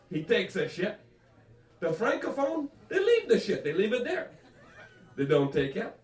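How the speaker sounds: high-pitched, insistent French Canadian mother; French Canadian accent. Retold or imitated. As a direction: lecture pushily in thick French Canadian English. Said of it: "That was my mother".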